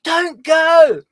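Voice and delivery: high, very loud screamed plea; desperate scream. Acted. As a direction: scream after someone leaving, long high cries. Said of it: "She's waving her arms and screaming".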